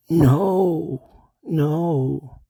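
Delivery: high, drawn-out no; prisoner's answer. Acted. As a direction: wail it high in character. Acted prisoner answers the doctor.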